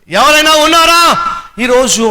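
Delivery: high, loud challenge to the church; preaching shout. Real, not acted. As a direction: call it out to the whole room. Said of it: "preaching challenge to congregation". (సభకు గట్టిగా, ఎత్తైన స్వరంలో ప్రశ్న)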